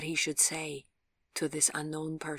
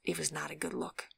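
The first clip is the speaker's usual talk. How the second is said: ordinary delivery, nothing marked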